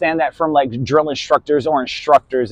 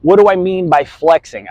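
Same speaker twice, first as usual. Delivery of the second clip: ordinary talk, nothing marked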